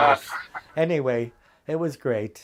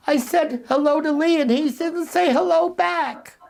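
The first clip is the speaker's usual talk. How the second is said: put-on much higher, hurt young woman's voice; high, wounded complaint; upset girl; 13 semitones higher, 4 dB louder than usual. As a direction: play the snubbed girl near tears. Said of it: "a girl came in from lunch crying".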